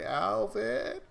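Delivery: lightly voiced small child; imitating a kid calling out. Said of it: "I heard him suddenly say".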